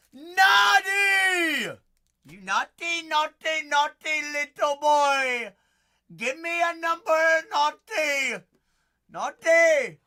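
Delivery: high, loud, teasing scold; teasing the viewer like a naughty little boy; mock scolding shout. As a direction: scold him like a naughty child, hammed up.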